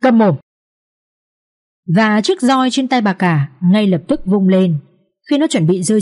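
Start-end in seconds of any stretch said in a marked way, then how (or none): 0.0-0.4 angry silencing. Acted. Mother-in-law silences daughter-in-law.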